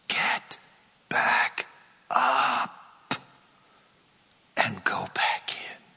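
the Lord whispering encouragement; gentle whisper. Acted. God's gentle command.

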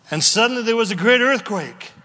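fast, loud, rising reading; fervent scripture proclamation. Real, not acted. Preacher reading the earthquake verse, fervent and fast. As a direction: build excitement through the verse.

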